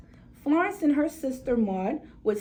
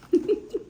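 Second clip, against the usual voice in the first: short laugh; 9 semitones higher than usual. Real, not acted. Narrator laughing at the argument.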